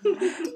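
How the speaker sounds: quick amused laugh; short laugh. Real, not acted. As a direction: laugh at yourself. Laughs after accent attempt.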